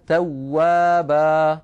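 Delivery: توابا slowly as "tawwaba", final a long; slow "tawwaba" ending on a long a; pausal alif. Shown as a demonstration. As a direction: hold the last a of توابا two beats. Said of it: "وفي الوقف توابا".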